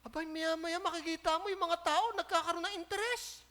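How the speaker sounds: normal talking voice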